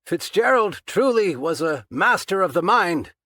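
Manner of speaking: strained. A narrator's reading. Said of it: "Patchen's reply sounded strained".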